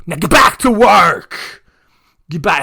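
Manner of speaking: mock Schwarzenegger shout. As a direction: bark the order at the cult members. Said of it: "it turned into Arnold Schwarzenegger there".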